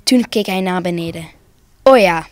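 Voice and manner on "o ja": louder, short exclamation of correction; calling out. A narrator's reading. Big Santa looks down and corrects himself, calling out. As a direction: call out the sudden correction. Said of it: "riep hij".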